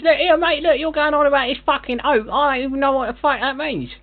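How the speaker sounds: irritated Cockney geezer; put-on London bloke losing patience; annoyed London accent. Prank caller in put-on London voice.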